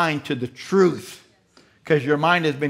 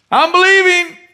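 high, loud stubborn whine; mock believer; 10 dB louder than usual. Peter stays in the boat.

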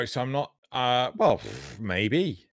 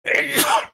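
a real, loud sneeze; sudden explosive sneeze; sneezing; 5 dB louder than usual. Real, not acted. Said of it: "sorry, I'm gonna sneeze".

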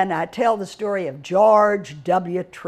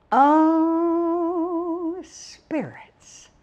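a high "oh", then "spirits" whispered; hushed reveal; 9 semitones higher, much slower than usual. Acted. To the audience, teasing the topic, whispered. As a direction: breathe "spirits" out like a secret.

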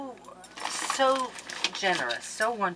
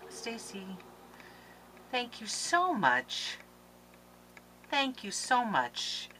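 heartfelt thanks; 5 semitones higher, 6 dB quieter, slower than usual. Real, not acted. Moved by donor's letter.

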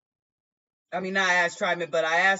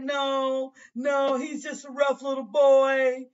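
higher voiced denying mother; pleading mother; 8 semitones higher than usual. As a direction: insist and beg to be let out. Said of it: "She said".

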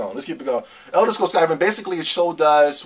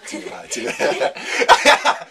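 laughing whisper; 4 semitones higher, 5 dB louder, much slower than usual. Real, not acted.

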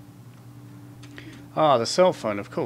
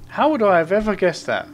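sarcastic; 6 semitones higher, 6 dB louder, faster than usual. Real, not acted. Sarcastic at obvious hint.

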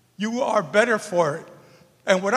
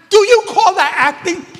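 even louder, much higher scornful repeat; imitating the director, scolding harder; 12 semitones higher, 10 dB louder than usual. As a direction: push the mockery up a notch, nearly yelling. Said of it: "and he looks at her and says".

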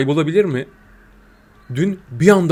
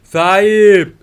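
louder, higher call for the owner; 5 semitones higher, 8 dB louder than usual. Real, not acted. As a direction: shout out to find someone.

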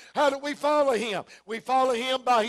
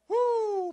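high happy whoop of praise; joyful whoop; 9 semitones higher than usual. Real, not acted. Preacher whooping with joy mid-sermon. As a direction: let out a shout of joy.

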